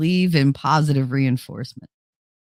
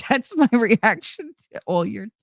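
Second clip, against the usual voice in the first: ordinary talk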